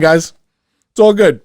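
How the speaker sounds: loud, high mock exclamation; mock shout. Podcaster hamming it up to listeners, mock loud shout. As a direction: overplay the surprise that nothing happened. Mock reaction after nothing happens.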